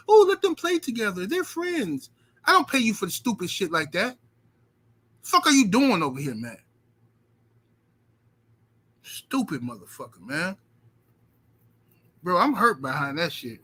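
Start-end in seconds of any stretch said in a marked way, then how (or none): 0.1-2.1 much higher mocking voice; mocking the worker. Owner mocking the yard guy's excuse in a higher voice. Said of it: "tree hugger". 2.4-6.6 angry scolding. Retold or imitated. Berating the yard guy. 9.0-10.6 muttered curse. Real, not acted. Muttered insult.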